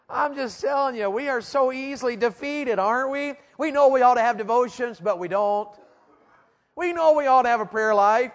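high, loud, driving preacher voice; preaching at full force. Acted. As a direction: preach it hard at the teenagers.

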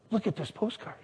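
very soft imitated wife; hushed wonder. Wife showing husband a postcard, hushed.

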